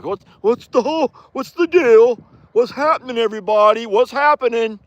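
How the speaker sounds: high, goofy horse voice; a high silly voice for the horse; voicing the horse. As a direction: speak for Roman in a silly high voice. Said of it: "That's not Roman's voice".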